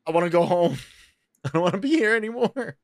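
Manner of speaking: high-pitched comic whining; Kevin Hart. Retold or imitated. Telling friends about the treehouse, comic whining. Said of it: "Sound like Kevin Hart".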